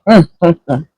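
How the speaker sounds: savoring hum. Real, not acted. Tasting the sandwich.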